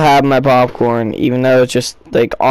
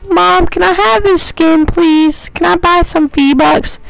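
very high, put-on begging voice; imitated kid asking for a treat; pleading kid. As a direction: beg mom in a silly falsetto. Acts out own past plea to mom.

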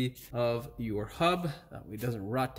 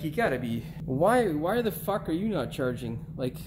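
usual speaking voice

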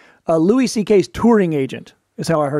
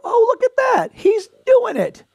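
put-on much higher, slow, doting old aunt voice; proud aunt; slower than usual. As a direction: coo it like a proud aunt watching TV. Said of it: "your mom and your Aunt Rhoda go".

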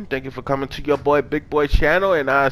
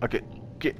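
soft whispered aside; 4 semitones lower, 12 dB quieter, much faster than usual. Real, not acted. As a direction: breathe it out under the breath. Whispered aside while playing.

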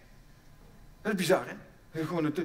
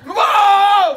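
shocked yell; 12 semitones higher, 17 dB louder than usual. Acted. Shout amid mock chaos.

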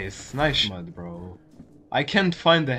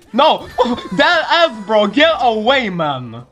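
1 laughing yell; 5 semitones higher, 3 dB louder than usual. Real, not acted. Yelling at attacker.

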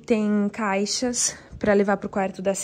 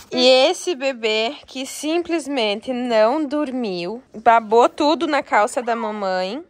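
normal talking voice

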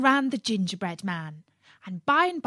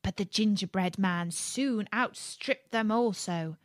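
usual speaking voice

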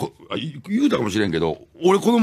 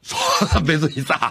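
ordinary talk, nothing marked

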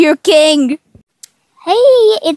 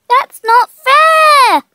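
slow, high, loud wail of protest; whiny protest shout; 9 semitones higher, 4 dB louder, much slower than usual. Acted. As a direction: drag out the complaint like a sore loser. Character loses a battle.

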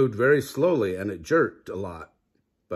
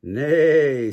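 louder neigh; 4 semitones higher, 7 dB louder than usual. A narrator's reading. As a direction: call out like a horse. Horse noise in story.

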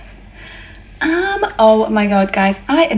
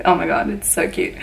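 ordinary talk

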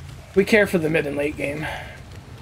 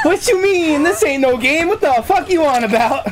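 squeaky high mock voice; high put-on voice; 9 semitones higher than usual. Streamer voicing a panicked survivor in a high squeak. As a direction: pitch up into a silly high voice.

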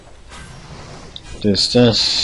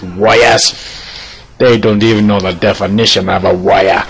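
grandly declaiming mentor; stern master character; 5 dB louder than usual. Acted. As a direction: proclaim it like a proud warrior master. Plays master answering pupil.

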